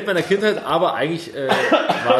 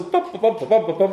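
banging noises; 6 semitones higher than usual. Retold or imitated. Imitating toy banging.

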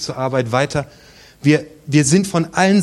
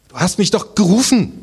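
put-on a boy insisting, pitched higher; insistent boy; 6 semitones higher than usual. (Samuel beharrt gegenüber Eli, höher und etwas lauter)

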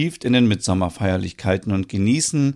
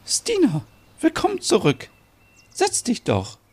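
very high, gentle mother voice; welcoming mother. Acted. Mother figure welcomes Stina back.

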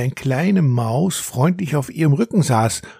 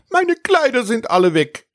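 high, outraged whine; indignant; 11 semitones higher than usual. A narrator's reading. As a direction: complain it furiously to his mother. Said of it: "antwortete Friedrich erbost".

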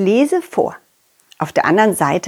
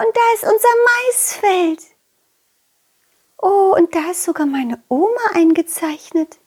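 put-on delighted butterfly girl; 9 semitones higher than usual. Butterfly girl admires map.

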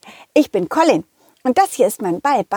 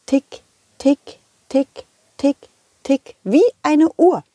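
crisp ticking like a clock; light mock clock ticking; ticking clock imitation; 3 semitones higher than usual. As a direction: tick it out evenly like a clock. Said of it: "die Zitrone machte tatsächlich".

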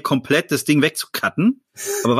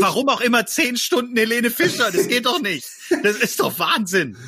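outraged disbelief; 11 semitones higher than usual. Real, not acted. Outraged at ten hours [name].

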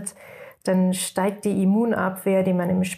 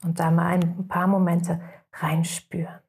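guided meditation. Acted. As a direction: guide the listeners into rest, unhurried.

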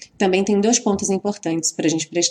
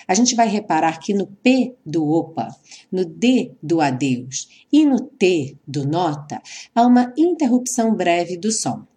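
normal talking voice

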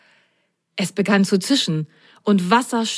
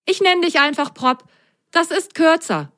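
higher-pitched, breezy sock character; pitched-up, carefree chatter; chattering away; 9 semitones higher than usual. A narrator's reading. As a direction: rattle it off cheerfully as little Milo. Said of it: "redete Milo drauf los".

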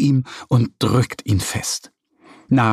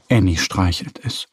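ordinary delivery, nothing marked

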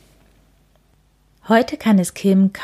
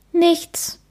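tearful small denial; 11 semitones higher than usual. Acted. Kim hiding tears.

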